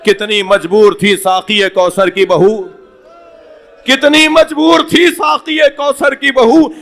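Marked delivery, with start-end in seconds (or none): 3.8-6.7 wailing cry. Acted. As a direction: lament it at full voice, breaking upward. The same couplet line repeated at a climax.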